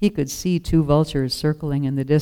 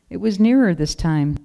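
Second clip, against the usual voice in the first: plain reading voice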